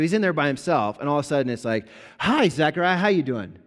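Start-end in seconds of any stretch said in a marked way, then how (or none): 2.2-3.5 bright, loud, high casual hello; breezy angel greeting. Retold or imitated. Angel popping into the temple, breezy loud greeting. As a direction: pop in like a cheerful angel saying hey.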